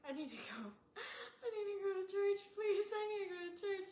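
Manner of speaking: high tearful pleading, near sobbing; crying plea. Retold or imitated. Survivor begging through tears to go to church, tearful high plea.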